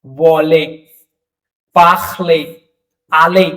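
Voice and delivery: वॉले, पाखले, आले each ending on a clear ए; French examples. Shown as a demonstration. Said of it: "जैसे".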